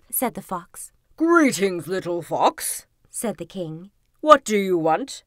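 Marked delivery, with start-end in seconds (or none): 1.2-2.9 loud opening, dropping low and slow; imposing king. A narrator's reading. King receiving the fox at his palace, imposing. 4.2-5.2 loud, imposing royal question; demanding king. A narrator's reading. King questioning the visiting fox, loud and demanding.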